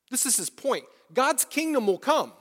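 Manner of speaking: ordinary talk, nothing marked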